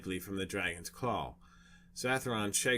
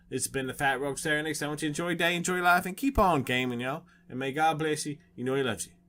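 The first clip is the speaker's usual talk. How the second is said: louder, higher, fast cheery outro; upbeat sign-off; 6 semitones higher, 6 dB louder, faster than usual. Real, not acted. Host signs off to viewers, upbeat and loud. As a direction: wave the viewers goodbye with energy.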